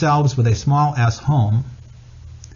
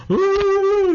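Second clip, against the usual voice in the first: high-pitched imitated bawling wail; hammed-up sobbing wail; drawn-out comic cry, far above normal pitch; mock blubbering. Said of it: "I'm blubbering".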